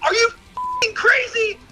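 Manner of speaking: high retold angry yell; exasperated shouted retort; yelling at crowd. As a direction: snap back at the onlookers. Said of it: "I was like".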